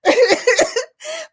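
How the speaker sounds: high laugh. Real, not acted. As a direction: burst out giggling. Laughing about scissors.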